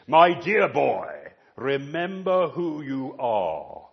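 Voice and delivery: a touch of a stern father, slow and loud; slow, weighty reminder from a stern king; stern royal father. Retold or imitated. King telling his son off, lightly stern and slow. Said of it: "he would end up by saying with George the fifth".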